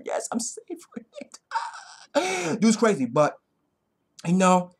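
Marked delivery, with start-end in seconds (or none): 0.0-2.6 high giggly gloat; hammed-up cackling relish; mock gloating. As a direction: break into a high, silly laugh while acting smug. Acts cocky fighter gloating over opponent.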